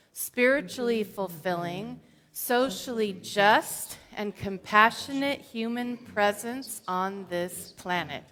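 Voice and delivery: ordinary delivery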